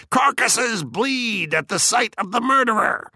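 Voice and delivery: very high-pitched outcry; mock scream. A narrator's reading. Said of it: "And you screamed".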